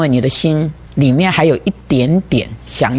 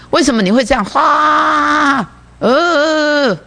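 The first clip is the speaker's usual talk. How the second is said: mock outburst; 8 semitones higher, much slower than usual. Preacher mimics an outburst.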